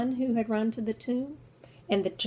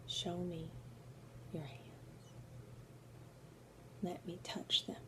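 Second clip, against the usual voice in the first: hushed doubt; 3 semitones lower, 16 dB quieter than usual. Acted. Doubting Thomas asks for proof.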